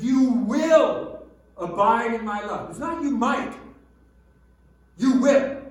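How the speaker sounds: loud, slowed, ringing sermon delivery; full-force preaching. Acted. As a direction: proclaim the scripture to the whole room.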